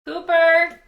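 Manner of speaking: sharp high call across the room; calling the dog. Real, not acted. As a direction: call the puppy's name loudly. Calling the puppy.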